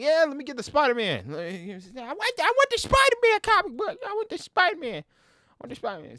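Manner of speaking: put-on high, pleading little kid voice; begging kid. A kid begging his mom for a Spider-Man comic, high and pleading. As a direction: whine for the comic like a small boy. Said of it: "I'd be like".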